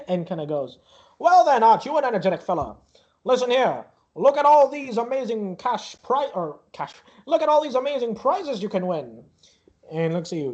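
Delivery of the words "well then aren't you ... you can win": higher-pitched, showy fairground salesman; carnival barker. Acted. Barker to an energetic child about prizes, showy pitch. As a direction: show off the prizes with a showman's patter.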